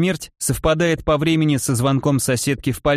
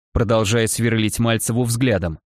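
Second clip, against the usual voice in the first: usual speaking voice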